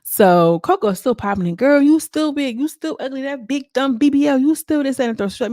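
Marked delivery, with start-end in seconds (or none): 1.6-5.3 mocking taunt. Voicing Coco taunting.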